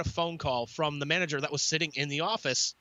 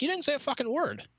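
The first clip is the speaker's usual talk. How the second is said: indignant retort; 11 semitones higher than usual. Retold or imitated. Confronting the manager.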